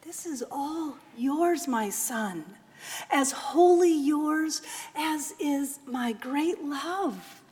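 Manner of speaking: mother gently comforting her son; tender mother. Acted. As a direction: hush a frightened child.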